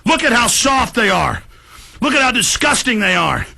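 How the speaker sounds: ordinary talk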